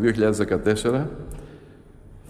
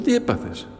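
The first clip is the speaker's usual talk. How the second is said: surprised question; 8 semitones higher than usual. Retold or imitated. Children ask the crying youth.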